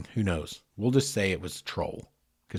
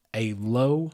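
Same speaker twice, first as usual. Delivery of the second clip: ordinary delivery, nothing marked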